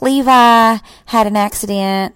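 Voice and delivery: loud, drawn-out imitation of the babysitter; slow, loud quoted news-breaking; mock announcement. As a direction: retell it slowly at high volume, imitating her.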